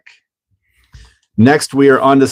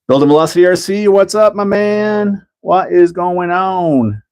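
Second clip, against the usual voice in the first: excited greeting; 8 semitones higher than usual. Real, not acted. Greeting a viewer in chat.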